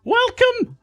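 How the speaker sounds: very high falsetto Geordie woman; Amaranth. Pretend. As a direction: put on a squeaky-high Geordie hostess. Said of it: "Amaranth, how you doing?".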